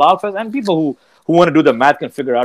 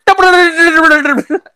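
high, rapid-fire mock Urdu babble; mock Urdu gibberish; 12 semitones higher, 4 dB louder than usual. Friend imitating his switch to Urdu, high mock babble. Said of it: "he just kicks into Urdu".

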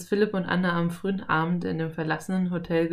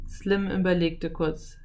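ordinary delivery

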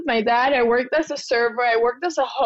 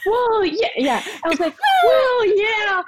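nervous high-pitched yes; 9 semitones higher than usual. Retold or imitated. Nervous applicant to embassy lady, high eager yes.

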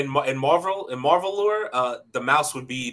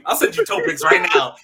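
excited joking. Real, not acted. Joking offer, voice shoots up.

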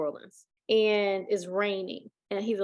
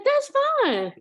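high sweet voice; 5 dB louder than usual. Retold or imitated.

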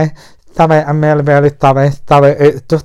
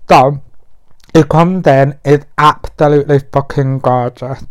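ordinary talk

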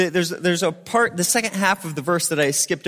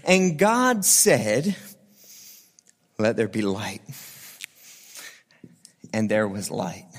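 solemn scripture reading; 6 semitones lower than usual. Acted. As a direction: read it low and reverent, like a poem. Reads Genesis one as poem.

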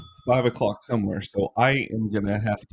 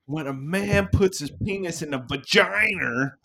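higher, lightly Boston teacher voice; Boston sex ed teacher; 6 semitones higher than usual. Retold or imitated. Host quoting a comedy bit as a Boston sex ed teacher. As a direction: play a lecturing Boston teacher, half-laughing. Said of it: "Boston teachers teaching sex ed".

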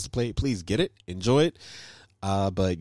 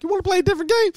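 very high-pitched mock suggestion; imitating tense friend; 4 dB louder, much faster than usual. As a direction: squeak out a strained offer, imitating a friend. Retelling friend after tense game.

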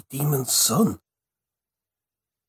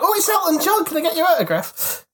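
high-pitched, gushing mock fan voice; excited fan; 13 semitones higher than usual. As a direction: squeal it like a starstruck fan, hammed up. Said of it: "everyone would be like".

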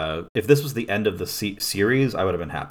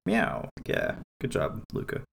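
lower, lightly voiced cat meow; 5 semitones lower, 5 dB quieter than usual. Luca the cat answering the crying teammates, low lazy cat voice.

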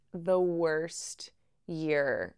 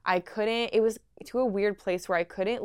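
normal talking voice

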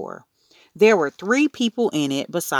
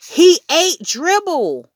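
very high, loud, drawn-out insistence; insistent; 13 semitones higher, 7 dB louder, slower than usual. A narrator's reading. Boy telling Mom his turtle was eaten, insistent. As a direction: insist at the top of your voice. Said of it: "I insisted".

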